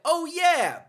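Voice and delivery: high, loud cocky "oh yeah"; mock tough quip. Heroine shoots the villain, loud cocky quip. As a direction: fire it off like a cocky action hero.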